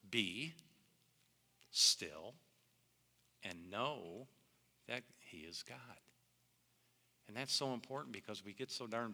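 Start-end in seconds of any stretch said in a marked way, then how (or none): 0.1-5.8 Be, still, and know, that he is God, each with a pause; broken down word by word. Shown as a demonstration. Pastor to congregation, slow word-by-word emphasis. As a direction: spell out Be still word by word with long gaps. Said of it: "you can break that down".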